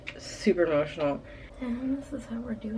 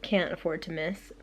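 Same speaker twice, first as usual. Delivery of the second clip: ordinary talk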